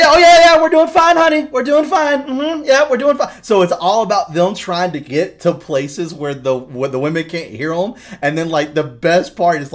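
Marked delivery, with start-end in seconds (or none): 0.0-3.4 very high, loud, sugary reassurance; nervous husband. Acted. Husband answering his wife upstairs, high and overly sweet. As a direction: panic covered with fake sweetness. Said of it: "He's like".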